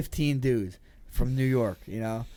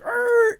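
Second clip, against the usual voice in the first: high, loud battle roar; charging yell; war cry; 8 dB louder than usual. Retold or imitated. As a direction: roar as the cars pull up. Five cars arrive, war cry.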